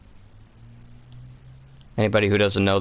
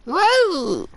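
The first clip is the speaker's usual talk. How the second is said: excited whoa. Retold or imitated. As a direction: shout whoa like the game voice. Echoing the game's exclamation.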